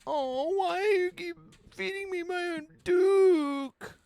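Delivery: very high squeaky complaining voice; mock whiny Magnus. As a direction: whine it like a pitiful captive, way up high. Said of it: "starts saying that a lot".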